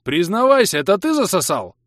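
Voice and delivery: high, loud accusation; roaring. A narrator's reading. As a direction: demand a confession at a near shout. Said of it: "взревел подлетевший игрок". (громко и требовательно, с наездом)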